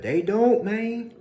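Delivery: high, loud exclamation; excited outburst. Real, not acted. Urging listeners, high loud outburst. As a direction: burst out with it.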